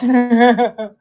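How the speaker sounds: loud laugh breaking out; loud burst of laughter. Real, not acted. Laughing at live chat.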